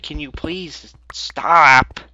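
a touch of mock annoyance; exasperated plea. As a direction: beg the coal to stop, weary. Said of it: "It's just like".

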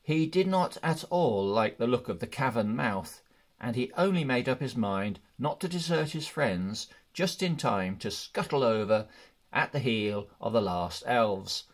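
plain reading voice

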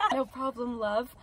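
soft, smooth-guy quote; lightly voiced charming crush; playing the guy. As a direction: say it softly, as the flirting guy. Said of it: "And I'm the guy".

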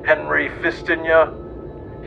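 normal talking voice

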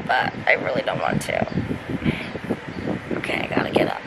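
sleepy whisper. Real, not acted. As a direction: whisper it, still half in bed. Groggy in bed, not wanting up.

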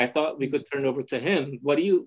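ordinary talk, nothing marked

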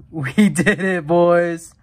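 real victory yell; excited shout. Real, not acted. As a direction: yell it out in triumph. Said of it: "I'm literally breathless".

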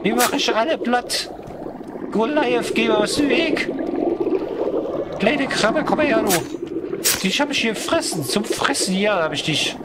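put-on high, swaggering drunk voice; drunk bravado. Drunk crab challenges the Goliath, high drunken bravado. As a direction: pick a fight like a tipsy brawler.

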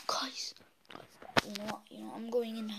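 tense hushed whisper; scared whisper. Real, not acted. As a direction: whisper before running in. Hiding from the clown.

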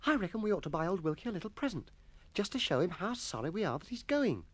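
put-on high-pitched boy with a bright idea; eager schoolboy suggestion. Voices Jennings suggesting idea.

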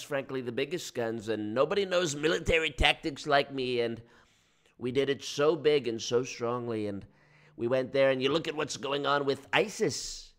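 lightly voiced Trump, brash and boastful; Trump impression. Streamer mocking a president on stream, brash boastful impression. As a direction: boast like a rally speech, big and proud. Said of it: "I'm doing the, it's like".

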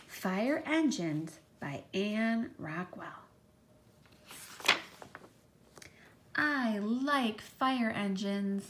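slow, clear picture-book reading; storybook read-aloud voice; reading to children. Acted. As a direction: read it slowly to a class of little ones.

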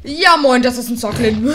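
loud sing-song hello; brash hey-there; mock loud greeting. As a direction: belt out a cheeky greeting.

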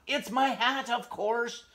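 high, loud indignant hedgehog; angry shout. Acted. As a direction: shout back, fed up. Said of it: "Hedgie shouted".

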